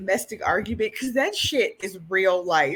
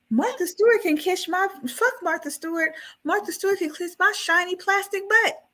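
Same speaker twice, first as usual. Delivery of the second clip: higher, indignant doll rant; angry Tiffany; 9 semitones higher than usual. Retold or imitated. Tiffany yelling at Chucky over the dishes, higher and indignant. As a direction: rant like an offended housewife.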